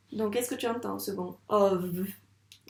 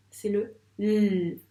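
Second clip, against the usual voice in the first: le l étiré lentement; étirer le l; l drawn out; 3 dB louder, much slower than usual. Shown as a demonstration. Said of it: "la dernière lettre". (lettre l étirée)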